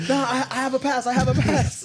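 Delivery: hammed-up high plea for mercy; mock pleading. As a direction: plead your case in a squeal-high put-on voice. Mimics someone pleading to be spared.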